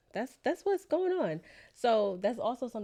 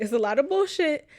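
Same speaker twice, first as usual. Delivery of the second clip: louder and higher, still laughing; laughing, louder insistence; laughing emphasis; 7 semitones higher, 8 dB louder than usual. Real, not acted. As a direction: hit it hard through the laugh. Let's not lie.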